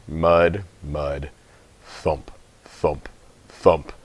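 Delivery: ordinary delivery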